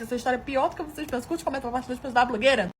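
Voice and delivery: ordinary talk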